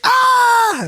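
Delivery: high yelp of shock at the fin; startled scream. Retold or imitated. Friend retelling his shark scare, sudden yelp. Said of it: "And I was like".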